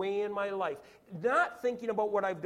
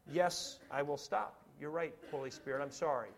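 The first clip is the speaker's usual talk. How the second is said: low, soft apology; meek repentance; 7 semitones lower, 6 dB quieter than usual. Acted. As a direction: give in quietly and humbly. Acts repentant reply to Holy Spirit.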